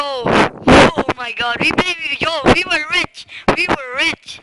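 loud, drawn-out celebratory shout; shrieking jackpot winner. Real, not acted. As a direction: cheer like you hit the jackpot. Big loot find, celebrating.

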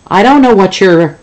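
high, loud blustering boss; angry zoo boss. Acted. Zoo boss starting to yell at the caller, loud and high. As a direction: snap down the phone.

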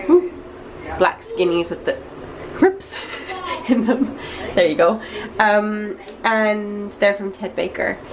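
ordinary talk, nothing marked

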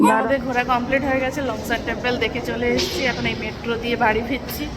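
softly summing up the trip for viewers; hushed vlog narration. Acted. Quiet vlog narration to camera.

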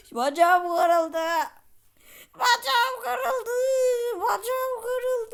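slow, high, loud hammed-up crying; mock wailing. Character wails slowly to the player about a broken leg. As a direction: wail it slowly like a hurt child.

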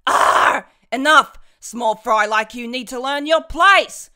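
loud furious roar at the small fry; angry villain yell. Acted. Villain yelling at weak mages to know their place. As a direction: bellow it down at them.

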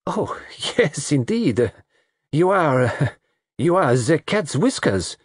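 gushing, laughing through the words; laughing flirt. A narrator's reading. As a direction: bubble over with flirty laughter.

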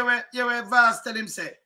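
ordinary talk, nothing marked

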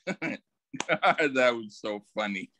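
ordinary talk, nothing marked

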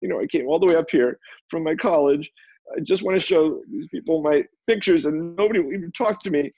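overwhelmed, near-tearful plea; losing it. Retold or imitated. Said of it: "I just sort of lost it".

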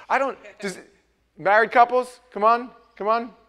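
loud, high, fired-up sermon riff; animated pulpit riff, loud; full-force preaching. Acted. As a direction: preach it to the married couples.

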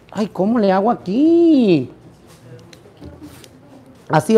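ordinary talk

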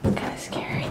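hushed whisper in the dark. Real, not acted. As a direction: whisper it, a little scared. Dark room, scared.

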